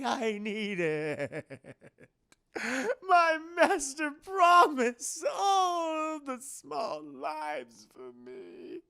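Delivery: very slow, wailing, cackling lunatic; crazed cackling madman. Retold or imitated. Renfield raves about his master, cackling and wailing.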